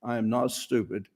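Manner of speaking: quiet steady reassurance; very calm. Shown as a demonstration. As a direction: say it very calmly to yourself. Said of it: "I would just say very calmly".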